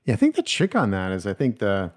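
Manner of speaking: ordinary talk, nothing marked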